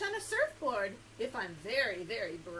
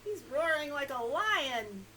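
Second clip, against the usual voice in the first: ordinary delivery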